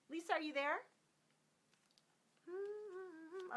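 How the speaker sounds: high sing-song call; sing-song calling a name. Acted. Calling out for a viewer.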